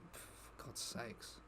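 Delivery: slow, breathy whisper of exasperation. Real, not acted. Whispering to himself as another streamer raids, exasperated. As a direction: sigh it out under the breath, slowly. Muttered aside as raid arrives.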